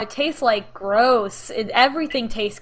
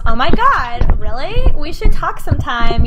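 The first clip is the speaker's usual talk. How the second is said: put-on gushing girl; 4 semitones higher, 9 dB louder than usual. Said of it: "Chevron goes".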